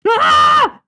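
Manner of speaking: sudden high piercing scream of shock; startled scream. Real, not acted. Streamer shrieks after ruining her drawing, startled scream. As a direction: shriek as the pen slips. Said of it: "that was really shocking".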